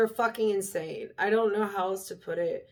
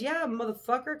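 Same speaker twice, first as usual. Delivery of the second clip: ordinary talk, nothing marked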